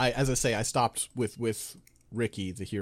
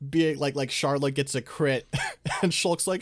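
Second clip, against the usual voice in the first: ordinary talk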